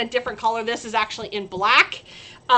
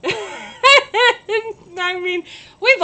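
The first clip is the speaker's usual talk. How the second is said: laughing, louder and higher; 7 semitones higher, 7 dB louder, much slower than usual. Real, not acted. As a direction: laugh it off while talking. Laughing at her own mistake.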